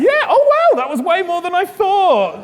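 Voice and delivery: very high, loud "oh wow", then slowing down; delighted surprise. Real, not acted. Host surprised by the big cheer.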